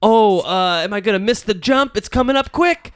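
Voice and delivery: high, rising frantic gamer voice; excited gamer. Pretend. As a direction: play it like racing to the jump. Acting out a speed game.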